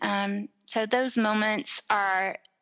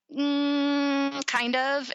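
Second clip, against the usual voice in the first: higher, drawn-out hedging hum; retold reluctance; 6 semitones higher, much slower than usual. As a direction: hedge before admitting it, pitched up. Retold reluctant admission to husband.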